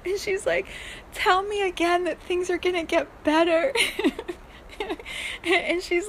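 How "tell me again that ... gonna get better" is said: high, pleading plea for comfort; a touch of a sad woman begging for hope. Retold or imitated. Sad woman in the dream asks to be reassured, high and pleading. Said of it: "like, so sad".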